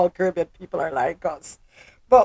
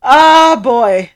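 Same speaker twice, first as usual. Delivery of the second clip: loud exclamation; 4 semitones higher, 12 dB louder than usual. Real, not acted.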